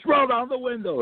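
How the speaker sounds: high, barking cop command; mock cop barking an order; cop's order. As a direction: mimic the angry trooper. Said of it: "And then he goes".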